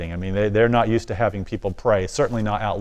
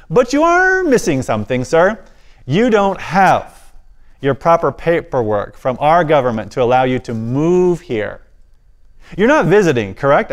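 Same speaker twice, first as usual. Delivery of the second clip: stern officer; 5 semitones higher, 4 dB louder than usual. Retold or imitated. Officer telling the family what they lack, stern. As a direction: lay down the missing paperwork gravely. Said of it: "his brow furrows and he says".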